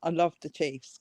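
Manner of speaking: usual speaking voice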